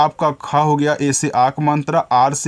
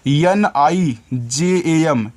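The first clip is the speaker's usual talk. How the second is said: slow, letter by letter; spelling letters out; much slower than usual. Shown as a demonstration. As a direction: spell each letter slowly for the children. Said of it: "teacher spells letters for children".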